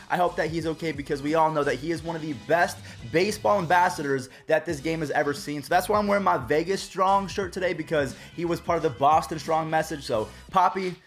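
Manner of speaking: normal talking voice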